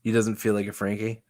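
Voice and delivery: ordinary talk, nothing marked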